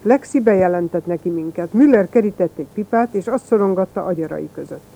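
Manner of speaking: ordinary delivery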